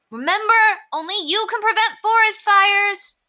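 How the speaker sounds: put-on a five-year-old proudly reciting a slogan; little girl shouting. A narrator's reading. Ramona shouting the slogan to the new lady, very high and loud. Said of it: "Ramona shouted back at the lady".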